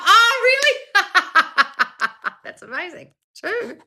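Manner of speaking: very high, far-off put-on gasp with a laugh; squeaky fake wonder then a giggle; mock amazement. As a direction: feign being blown away.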